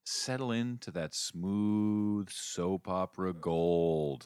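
slow, drawn-out silky delivery; playful, lingering showbiz flourish; mock-smooth reveal. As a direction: stretch it out luxuriously, savoring each word. Mock-grand reveal of soap career.

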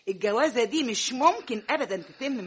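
a final, emphatic ruling against the marriage; emphatic verdict. Acted. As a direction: lay it down word by word. Mother declares marriage off.

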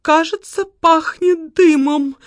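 выше, медленно, с тревогой принюхиваясь; насторожённо, с нарастающим страхом; испуганно. A narrator's reading. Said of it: "испуганно проговорила". (испуганно, выше и медленно)

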